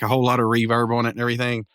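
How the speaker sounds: ordinary delivery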